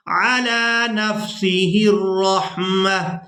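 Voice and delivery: slow melodic tajweed recitation; Quran recitation. Shown as a demonstration. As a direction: chant the verse slowly, long vowels held. Recitation continues.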